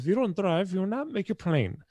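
imitating lady driver. Driver warning her passenger about the plane, emphatic imitation. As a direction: imitate a firm, high-voiced ultimatum. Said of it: "the lady who was transporting me".

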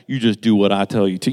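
low, quick mock-mama order; imitated firm mama. Mama closing the argument at the end of the day, imitated firm order. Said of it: "Mama was like".

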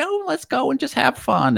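high, light imitation of the wife; wife's voice. Mimicking the wife on the plane, high and breezy. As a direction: squeak it out like a cheerful wife. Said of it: "She's like".

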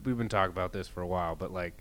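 ordinary talk, nothing marked

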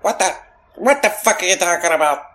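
high, put-on voice of his buddy; a friend's voice, baffled and a bit rough; imitating his friend. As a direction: mimic how the friend talks, incredulous. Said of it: "he talks like this".

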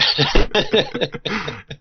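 high-pitched burst of laughter; laughing. Real, not acted. Host laughs at the email's accent jibe, cracking up. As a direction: break off to laugh at the joke.

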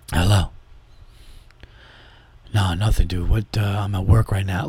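hushed guarded answer at the desk; work voice. Retold or imitated. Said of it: "calling my friends with the work voice".

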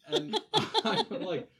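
laughing through the words, high and loud; can barely get it out for laughing. Real, not acted. Laughing while telling.

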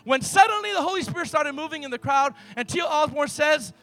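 loud, driving sermon delivery that builds to a shout; full-force preaching. Acted. Pastor to the congregation, telling the healing in a loud preach. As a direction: preach it to the rafters, pushing every line.

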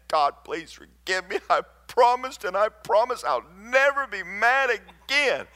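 high-pitched, hammed-up begging; pleading prayer. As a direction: plead desperately, rising in pitch. Acting out desperate prayer at home.

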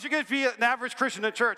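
normal talking voice

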